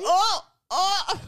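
high laughing mock "oh, oh"; pained yelps. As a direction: imitate her groaning in pain. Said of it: "You were like".

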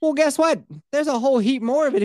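spring the surprise like an ad; hype reveal. Acted. Ad pitch reveal.

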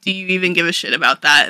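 ordinary talk

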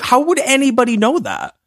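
high, loud disbelieving outcry; incredulous. Retold or imitated. Recalling learning Jenny had AIDS, high incredulous outcry. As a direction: squeal it in total disbelief. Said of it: "I was like".

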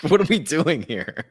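usual speaking voice

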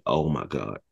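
low, soft stunned reaction; hushed shock. Retold or imitated. Retelling stunned reaction to ditch.

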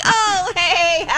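high, falsely casual hello; mock caught-out greeting. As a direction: imitate gossips caught out, pitched high. Imitating colleagues caught gossiping.

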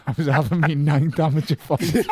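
loud, high, through laughter; helpless laugh pushing the words up. Real, not acted. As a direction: crack up while telling it. Cracking up at own boast story.

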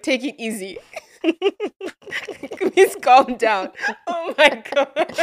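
words broken up by real laughter; helpless laughter while talking; laughing. Real, not acted. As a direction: explain it through giggles. Cracking up while explaining Nisuru.

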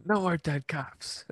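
high, hammed-up line reading; overdramatic extra. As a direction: deliver it like a bad extra. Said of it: "I'm glad you brought up that line".

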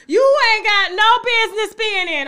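indignant telling-off. Real, not acted. Telling viewers to stay out of it, loudly indignant. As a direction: put critics firmly in their place. Telling off critics of her interview.

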